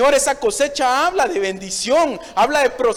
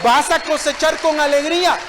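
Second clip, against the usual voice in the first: ordinary talk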